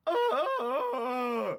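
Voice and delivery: sudden high yelp of frustration, imitating the boss; frustrated howl. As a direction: imitate a boss's high, loud howl of annoyance. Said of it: "a howl of frustration".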